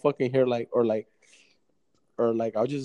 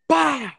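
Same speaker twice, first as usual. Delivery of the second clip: loud mock engine backfire bang, higher; backfire noise; 12 semitones higher, 10 dB louder than usual. Telling friend the car backfired, loud bang noise. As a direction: blurt a car's backfire.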